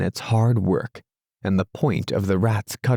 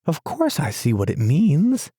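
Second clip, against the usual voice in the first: higher, fussy little mole voice; a doubtful mole who can't see the point; Mole, stubborn and puzzled; 7 semitones higher than usual. A narrator's reading. Mole's voice in story.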